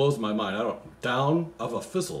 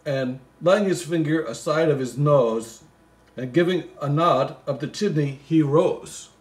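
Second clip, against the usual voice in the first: reads the Christmas poem aloud, a bit louder; poem reading; 3 semitones higher, 6 dB louder than usual. Acted. Comic reader reciting the Christmas poem, lightly sing-song. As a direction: recite the verse with a touch of storybook lilt.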